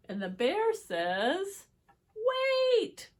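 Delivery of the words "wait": loud high wait!; high shout. Acted. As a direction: cry out to stop them. Bear interrupts loudly.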